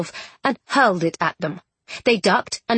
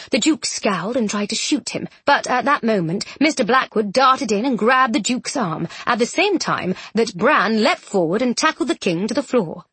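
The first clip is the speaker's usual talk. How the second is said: plain reading voice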